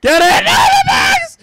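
high, loud panicked scream; panicked shout. Real, not acted. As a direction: shriek in alarm. Game chaos, dropping bags.

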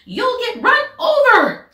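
shrieked high and loud warning; panicked squawk. Acted. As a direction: scream a frantic warning in a duck's squawk. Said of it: "squawked Missus Mallard, all of a dither".

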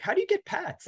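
high, squeaky mock question; puzzled people. Mimicking puzzled people asking about pet scans, in a high mock voice. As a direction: imitate baffled people asking in a high pitch. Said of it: "Everybody's like".

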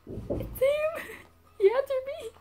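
high, loud thrilled squeal; excited squeal. Retold or imitated. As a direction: squeal it, giddy at his reply. Sees his message arrive.

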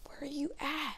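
soft unvoiced whisper. Real, not acted. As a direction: whisper the question. Whispering while searching.

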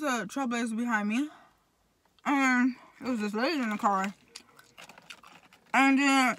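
speaking between bites; talking while chewing. Real, not acted. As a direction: mouth partly full. Seen 2: bites food at -2 to 0.2, then talks with jaw working.

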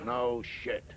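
deep, stretched American reaction; deadpan American. Acted. American repeating his reaction, low drawn-out deadpan. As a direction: let it out low and slow. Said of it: "The Americans don't say that anymore".

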